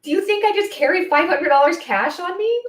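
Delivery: mock-indignant shriek at the clerk; incredulous. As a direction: play the customer who can't believe it. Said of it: "I'd be like".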